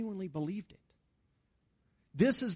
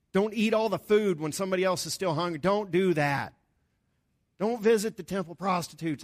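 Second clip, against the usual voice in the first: louder, faster, Paul scolding the church; mock rebuke; 2 semitones higher, 6 dB louder than usual. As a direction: hammer each "don't" like a stern apostle. Voicing Paul's list of rebukes.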